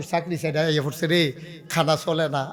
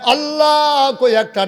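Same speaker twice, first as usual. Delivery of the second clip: high, loud preacher's call; soaring imitated plea; imitated cry to Allah; 9 semitones higher, 7 dB louder, slower than usual. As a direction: call out to Allah as the woman would. Preacher voices woman crying to Allah.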